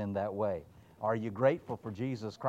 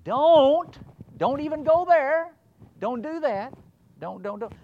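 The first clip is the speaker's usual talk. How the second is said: much higher, much louder hellfire don'ts; shouted don'ts; 13 dB louder than usual. Retold or imitated. Preacher parodying his own loud don't-preaching. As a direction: yell the prohibitions like a fire-and-brimstone preacher. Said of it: "sin has a lot of don'ts".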